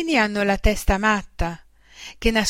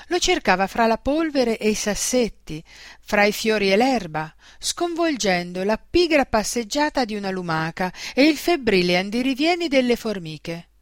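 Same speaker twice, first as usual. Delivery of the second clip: usual speaking voice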